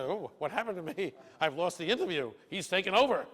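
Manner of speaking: high, laughing mock complaint; a touch of the interviewer's amused helplessness; mock dismay, laughing. Interviewer laughing at lost control.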